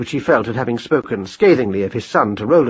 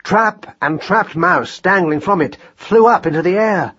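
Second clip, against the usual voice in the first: ordinary delivery, nothing marked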